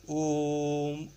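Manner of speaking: ओम held long and low; drawn-out om. Shown as a demonstration. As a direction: chant ओम slowly. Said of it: "जैसे ओम लिखते हैं". (ओम को लंबा खींचकर)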